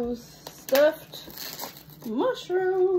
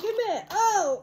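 ordinary talk

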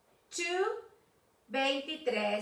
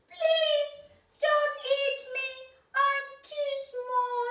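high, pleading tiny goat; little goat voice; 11 semitones higher than usual. Acted. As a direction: beg in a small high voice. Small goat begs the monster.

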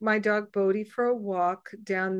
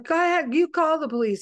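higher, louder mock challenge; threatening retort; 7 semitones higher, 4 dB louder, faster than usual. Angry neighbor dares her to call police, higher, louder. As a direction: fire it back, higher and a bit louder. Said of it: "He was very threatening".

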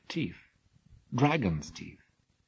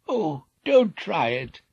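slow, higher pleading; 7 semitones higher, 5 dB louder, slower than usual. Acted. Old king begging Jason to stay, pleading. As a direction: beg him not to go. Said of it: "begged Phineus".

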